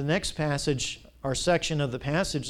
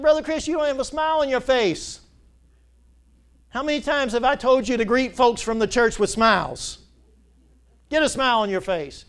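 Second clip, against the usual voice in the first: higher and louder, mock scolding a corpse; hammed-up pastor scolding; 8 semitones higher, 7 dB louder than usual. Pastor mock scolding a dead church member, loud and high. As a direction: scold him like he can hear. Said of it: "I said".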